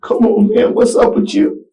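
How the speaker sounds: put-on high, loud panicked calls to the child; alarmed, trying to rouse him. Father reliving panic as the child's eyes rolled, high and loud. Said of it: "and I was like".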